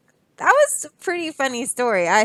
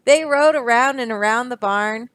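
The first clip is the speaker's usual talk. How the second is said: ordinary delivery, nothing marked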